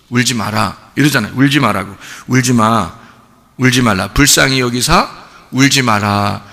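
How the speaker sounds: a hint of Jesus soothing a mother; gently voiced Jesus. Jesus telling the grieving widow not to cry, low and soft.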